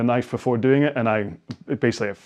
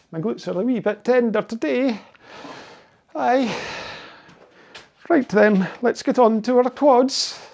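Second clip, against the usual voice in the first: hammed-up Scottish brogue, pitched higher; mock Scottish accent; 11 semitones higher than usual. Coach joking about his own country, in an exaggerated Scottish accent. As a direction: play up a broad Scottish accent for a joke. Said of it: "I'm gonna get chased out of my own country".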